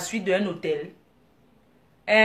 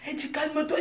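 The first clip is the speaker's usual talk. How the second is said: usual speaking voice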